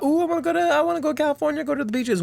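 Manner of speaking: very high, gushing mock voice; mocking beach-lovers. Mocking people who wanna go to California, high gushing voice. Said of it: "What do people talk about?".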